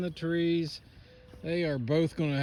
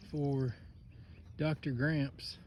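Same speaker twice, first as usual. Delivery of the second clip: usual speaking voice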